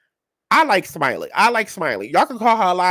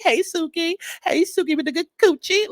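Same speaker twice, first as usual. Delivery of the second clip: high, sing-song mock greeting; imitating Rolly's greeting; 9 semitones higher than usual. Host imitating Rolly greeting Suki, high and sing-song. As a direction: sweeten it up high like a fake-friendly hello. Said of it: "she was like".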